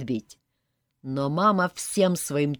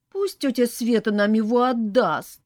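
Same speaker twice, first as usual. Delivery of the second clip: high, loud, tearful pleading; acted child whimper; 7 semitones higher, 6 dB louder than usual. A narrator's reading. Little girl wants the horse handed over, mock whimpering. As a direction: beg like an upset little girl. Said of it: "всхлипывала маленькая Марина".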